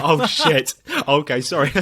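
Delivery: startled mock-awkward backpedal; embarrassed shock. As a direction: caught out, blurting a sorry. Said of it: "You're like".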